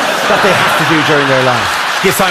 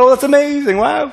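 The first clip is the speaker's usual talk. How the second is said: mock amazement; 9 semitones higher than usual. Colleague reacting to engagement news.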